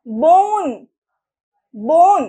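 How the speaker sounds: বোন, loud with a drawn-out vowel; long O sound. Shown as a demonstration. Teacher showing that long sounds take time, long O in বোন. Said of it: "time লাগছে তো".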